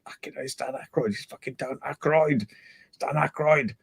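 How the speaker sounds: softly starstruck, hardly believing who is on the line; starstruck hush. Retold or imitated. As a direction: murmur it to yourself in disbelief. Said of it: "and I was like".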